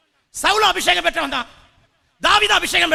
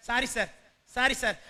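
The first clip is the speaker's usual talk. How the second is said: low, soft mock apology; small, deferential voice; meek apology; 3 semitones lower, 8 dB quieter than usual. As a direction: act out backing down quietly. Acts backing down when scolded.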